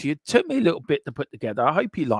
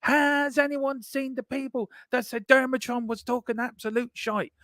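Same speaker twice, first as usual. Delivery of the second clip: crowing mock announcement; 12 semitones higher than usual.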